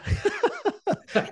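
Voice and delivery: high, breathless burst of laughter; real laughter. Real, not acted. Founder asked about hiring the guy, real laughter. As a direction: crack up at the question. Cracks up at host's question.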